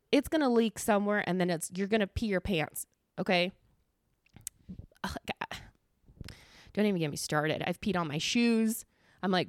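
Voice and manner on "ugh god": whispered groan of disgust; weary, whispered exasperation; exasperated whisper. Real, not acted. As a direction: breathe it out, fed up and barely voiced. Exasperated aside about outdoor peeing.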